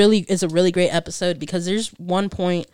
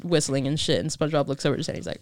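ordinary delivery

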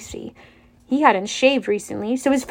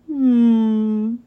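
cow lowing. Acted. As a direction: hum it out like a nervous cow. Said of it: "Bessie lowed".

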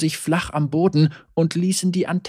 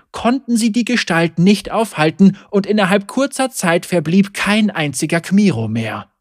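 usual speaking voice

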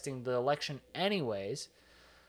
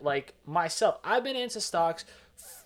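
ordinary delivery

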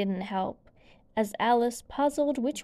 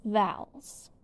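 usual speaking voice

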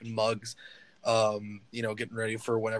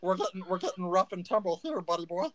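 lightly voiced gnome itching for a fight; scrappy gnome; 9 semitones higher, 13 dB louder than usual. Gnome Stitch challenges the taller man to fight, scrappy. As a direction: puff up like a tiny brawler.

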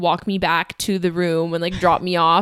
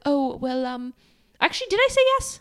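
soft, higher, halting British imitation; awkward British accent; 6 semitones higher, 5 dB quieter than usual. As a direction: lightly play an awkward British dad. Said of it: "he was so awkward".

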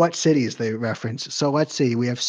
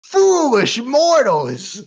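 slow, loud, high villain sneer; slow theatrical villain menace; 10 semitones higher, 6 dB louder, much slower than usual. Pretend. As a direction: taunt the mortals like a cartoon overlord. Villain taunt after laugh.

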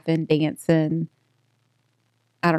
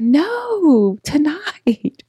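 put-on high, insistent kid's no; little girl voice; 9 semitones higher, much slower than usual. Child refusing to wait, high insistent kid voice. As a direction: dig in like a stubborn child. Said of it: "And she's like".

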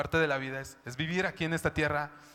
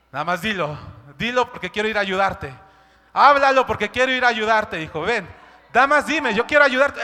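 louder, higher, urgent invitation; God calling out; 4 semitones higher, 9 dB louder than usual. Pretend. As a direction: call the child to speak up. God voiced calling his child. (Dios llamando a su hijo con fuerza)

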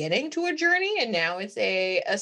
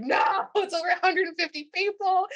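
high, loud, flustered denial; mock panic; 11 semitones higher, 3 dB louder than usual. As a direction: protest like a horrified groom, hammed up. Acting groom panicking at objection.

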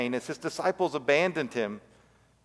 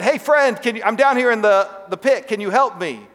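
much higher and louder, hailing a friend from below; calling out; 6 semitones higher, 5 dB louder than usual. Acted. Man stuck in the pit hails his friend, high loud call. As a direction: yell up to catch the friend's attention.

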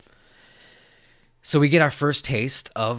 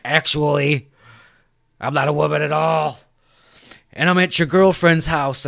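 loud, higher put-on villain voice; mock Ghostface; 5 semitones higher, 8 dB louder than usual. Killer revealing himself to Cotton by phone, menacing. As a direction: announce the reveal at full volume. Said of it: "Is the voice changer thing is like".

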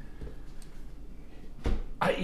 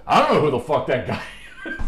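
raised, louder holler with a laugh at the end; retold yell; 5 semitones higher, 6 dB louder than usual. Coach yelling over to the other coach, indignant holler. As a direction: imitate a coach yelling across the field. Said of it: "he yells over to Joe Redman".

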